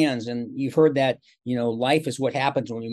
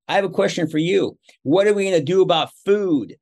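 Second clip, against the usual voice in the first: son's voice pushed higher and louder, half laughing; higher, louder imitation of his teenage son worrying about food; worried teenage son; 5 semitones higher, 5 dB louder than usual. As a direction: play the anxious teen asking about dinner.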